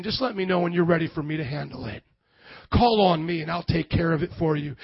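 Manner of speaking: calm reassurance. Acted. Preacher voicing Jesus offering to handle it, calm and low. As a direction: drop low and take it easy. God's unworried reply after scream.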